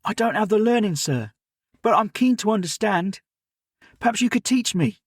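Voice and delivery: put-on a keen boy apologising to his elder; eager servant boy Jim.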